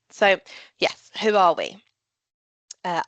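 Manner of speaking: ordinary delivery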